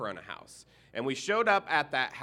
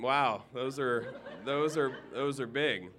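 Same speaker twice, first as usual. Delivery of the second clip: laughing. Real, not acted. Minister laughing at dog.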